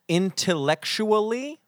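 drawn-out intellectually, as a question; sounding out syllables. Shown as a demonstration. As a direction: offer the word beat by beat. Sounds out word to count syllables.

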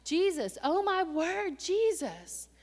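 loud pleading cry to Jesus; loud, urgent plea to God; desperate loud prayer. Retold or imitated.

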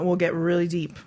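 usual speaking voice

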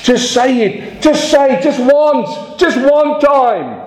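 high, insistent begging, slowing on the last plea; crowd begging. Retold or imitated. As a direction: plead with him high and urgent, stretching the last line slowly. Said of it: "people were crying out".

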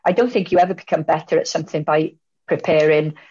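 normal talking voice

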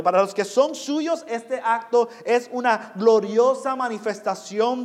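loud, high-pitched sermon voice, building; impassioned proclamation to a congregation; full-force preaching. Real, not acted. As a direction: preach it out with mounting intensity.

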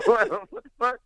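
high, laughing disbelief; mock-shocked squeak through a laugh; shocked outburst. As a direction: blurt it out half laughing. Shocked reply retold.

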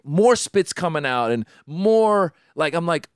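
ordinary talk

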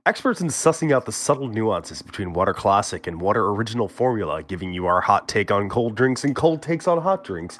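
mock-grand show host intro; podcast announcer voice. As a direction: read it like a pompous radio host.

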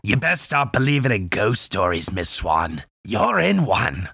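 put-on pirate voice, last words drawn out; menacing movie pirate, slowing to the finish; pirate accent. Host performs the requested ghost stories line, pirate accent. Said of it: "I'll do it".

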